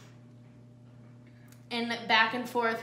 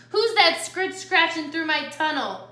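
louder, higher growled challenge; growling; 6 semitones higher, 6 dB louder than usual. A narrator's reading. Coyote challenges whoever is in the tunnel, lightly growling. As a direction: challenge the intruder in a raised voice. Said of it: "growled the coyote".

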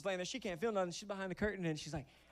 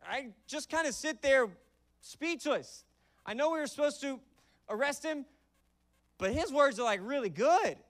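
awestruck guard; 7 semitones higher, 6 dB louder than usual. Pretend. Voicing awestruck guard, playful.

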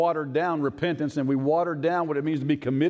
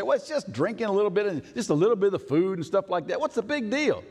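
higher, dismissive listener voice; mock excuse; 7 semitones higher than usual. Preacher voicing a listener's dismissive shrug, higher. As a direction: shrug it off as nothing. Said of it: "You say".

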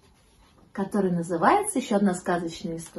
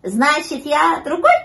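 high, loud puppy question; high-pitched dawning realisation; puppy catching on; 6 semitones higher, 7 dB louder, slower than usual. A narrator's reading. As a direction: work it out aloud in a pup's voice. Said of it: "догадался щенок".

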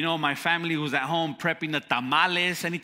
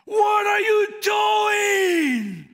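very high, louder, slow angry shout; 6 dB louder, much slower than usual. Retold or imitated. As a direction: yell it down the phone at the newbie. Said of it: "he was so mad".

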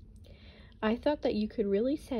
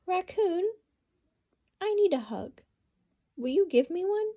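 higher, louder hopeful hedgehog voice; pleading little hedgehog; 9 semitones higher, 5 dB louder than usual. Acted. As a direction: ask like a small lonely hedgehog. Hedgehog asks Raccoon.

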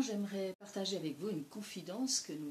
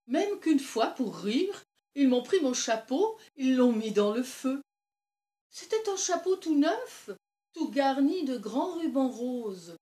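loud, higher, animated village anecdote; excited old storyteller; 6 semitones higher, 13 dB louder than usual. Acted. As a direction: tell the hat prank with mounting excitement. Old Lise excitedly tells hat anecdote. (vieille conteuse exaltée, voix forte et plus haute)

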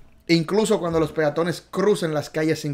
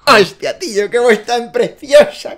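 louder, higher mock Spaniard gushing; Spain accent; 8 semitones higher, 10 dB louder than usual. Teacher swooning in a mock Spanish accent over his friend's voice, higher and louder. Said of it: "se me ha quedado el acento".

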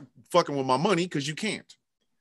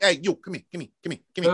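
lightly voiced De Niro; faster than usual. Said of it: "like a little Robert De Niro".

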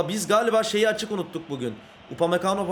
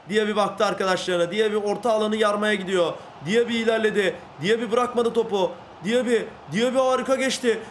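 building excitement; 3 semitones higher than usual. Real, not acted. Gamer calling his winger's run to viewers, building excitement. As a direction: ride the run, building to a peak. Live play-by-play of a dribble.

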